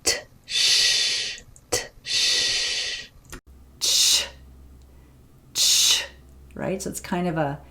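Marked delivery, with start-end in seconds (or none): 0.0-6.0 "t, sh" split then merged into "ch". Shown as a demonstration. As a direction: build "ch" from its parts. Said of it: "release it into the fricative".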